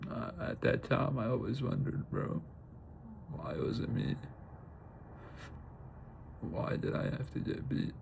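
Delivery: choked, barely voiced questions; real crying. Real, not acted. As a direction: voice giving out through tears. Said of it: "I'll actually start crying a whole lot more".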